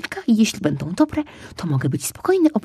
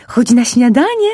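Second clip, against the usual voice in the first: higher, louder call; mother calling; 7 semitones higher, 9 dB louder than usual. Acted. Mother calling cub to breakfast, loud. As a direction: summon him to eat. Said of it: "zawołała mama".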